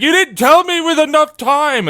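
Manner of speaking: high whiny imitation of family; high, mocking impression of a dismissive relative. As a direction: mimic their excuse in a high whine. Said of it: "It's like".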